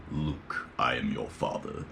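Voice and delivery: hushed low Vader impression; Darth Vader voice. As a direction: imitate the famous dark lord line. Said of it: "also Darth Vader".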